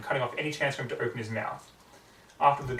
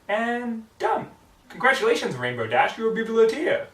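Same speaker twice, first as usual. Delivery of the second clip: excited; 9 semitones higher, 5 dB louder than usual. Acted. Twilight excitedly finishing card.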